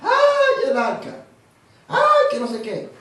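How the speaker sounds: high wailing "ay" then loud whining complaint; mock lamenting wail. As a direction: whine like a mourner, hammed up. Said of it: "Vivían lamentándose".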